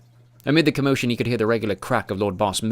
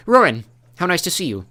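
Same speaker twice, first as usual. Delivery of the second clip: too cheery; 9 semitones higher, 7 dB louder than usual. A narrator's reading. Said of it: "with a smile that seemed altogether too cheery".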